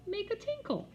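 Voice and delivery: put-on very high child voice; innocent little girl. A narrator's reading. Jenny explaining to Peter, very high innocent voice. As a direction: explain it like a toddler.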